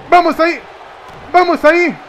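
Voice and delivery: high, loud rallying shout; excited cheer. Real, not acted. Cheering on his own move. (grito de ánimo)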